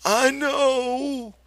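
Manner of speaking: very high, loud, miserable; a child who knows he's wrong; miserable Lenny. A narrator's reading. Lenny admits his fault to his aunt, high and miserable. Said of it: "Lenny said miserably".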